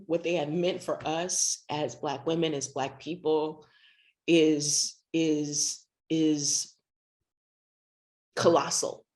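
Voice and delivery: tearful, voice thick and low; holding back tears while thanking; after crying. Real, not acted. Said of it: "I've been crying so much".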